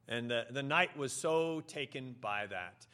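ordinary talk

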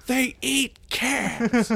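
very high, slowly drawn-out cry; mock wail. Coyote shouter defends himself to onlookers, high drawn-out mock wail. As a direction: stretch it into a high comic protest.

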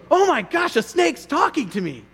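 high, startled gasp of surprise; suddenly spooked by a talking snake. Pretend.

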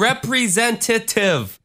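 high, slow mock of a botched "representative"; mockingly overpronounce "representative"; exaggerated, slowed "representative", needling a friend. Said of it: "I was mocking you".

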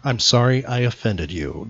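usual speaking voice

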